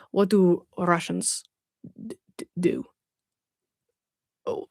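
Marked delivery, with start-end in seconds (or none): 0.1-2.8 Russian accent. Shown as a demonstration. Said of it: "I've gotta do my Russian accent".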